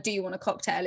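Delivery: soft, low-key drop on cocktail; question as statement. Shown as a demonstration. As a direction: ask it without really asking, cocktail falling. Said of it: "is never really a question".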